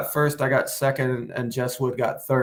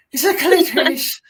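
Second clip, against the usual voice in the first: high, loud Irish lilt, laughing through it; hammed-up Irish accent on wee little bean; mock Irish teacher; 13 semitones higher, 6 dB louder than usual. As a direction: play the silly Irish teacher with a giggle.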